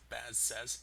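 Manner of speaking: ordinary talk, nothing marked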